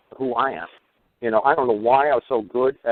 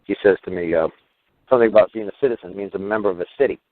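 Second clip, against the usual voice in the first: ordinary delivery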